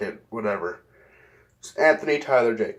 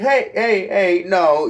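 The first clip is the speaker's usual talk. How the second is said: calling out; 7 semitones higher, 5 dB louder, slower than usual. Retold or imitated. Cops calling him back.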